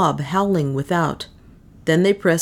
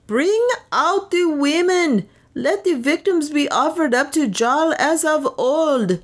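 enraged mob yell; 10 semitones higher than usual. A narrator's reading. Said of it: "the multitude yelled in their rage".

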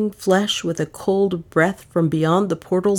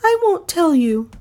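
much higher, firm refusal; defiant refusal; 13 semitones higher, 3 dB louder than usual. Acted. Dale refusing the detective, high and firm. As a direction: dig in and refuse. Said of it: "Dale's jaw set".

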